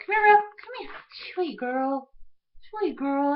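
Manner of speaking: high cooing pet voice. Real, not acted. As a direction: coo sweetly to a puppy. Calling and cooing to her dog.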